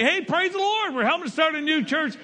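upbeat mock cheer, high then slowing; mock cheerful praise. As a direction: sell the new-church excitement. Congregation quoted, cheerful enthusiasm.

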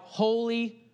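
mild reenacted angelic cry of worship; seraphim crying out. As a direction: lift the first two holys up, drop the third like an amen. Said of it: "They cried out".

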